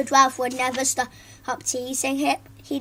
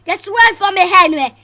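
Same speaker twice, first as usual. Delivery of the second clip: loud, high furious rebuke; angry hiss; 6 semitones higher, 12 dB louder than usual. Acted. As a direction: push him off in disgust. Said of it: "hissed Claire".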